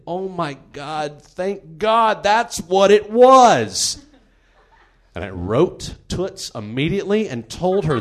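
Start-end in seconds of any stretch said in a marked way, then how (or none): 0.1-4.0 slow, building into a loud, high exclamation; excited relief. Retold or imitated. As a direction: stretch it out with hammed-up, overjoyed relief. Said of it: "I was so excited".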